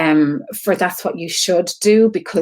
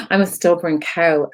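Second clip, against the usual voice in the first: usual speaking voice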